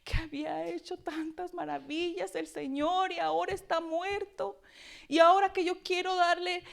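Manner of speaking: a touch of anguished sobbing voice; weeping lament. Acted. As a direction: cry out for her lost Lord. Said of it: "Lloraba, hermanos".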